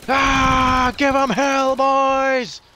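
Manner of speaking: high, loud commander shout; rally the troops with a yell; battle cry. Pretend.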